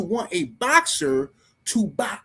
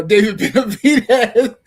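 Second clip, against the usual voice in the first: high, loud laugh breaking through the name; cracking up in disbelief at the fans' comment; 3 semitones higher, 7 dB louder than usual. Real, not acted. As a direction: burst out laughing mid-name.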